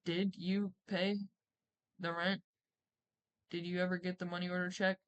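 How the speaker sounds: corner him with each word; quiet accusing question. Retold or imitated. Slow suspicious question to husband.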